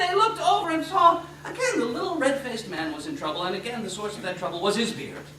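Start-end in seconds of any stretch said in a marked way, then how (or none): none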